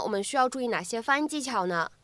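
usual speaking voice

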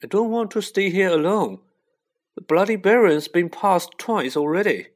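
high, loud, frightened protest; anxious plea. A narrator's reading. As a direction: plead not to be abandoned. Neville fears staying alone.

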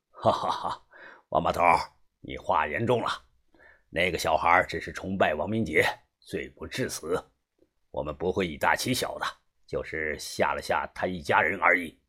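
low-voiced man reporting to a boss; middle-aged fixer, assured. (中年男人低声笃定地汇报)

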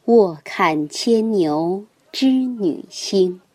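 slow, measured poem reading; poem recitation. Acted. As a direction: recite it slowly and evenly. Reads a classical poem.

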